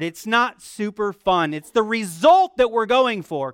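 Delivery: ordinary talk, nothing marked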